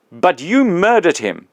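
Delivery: put-on the big ghost confronting his old employee, loud and aggrieved; indignant big ghost. Ghost accuses.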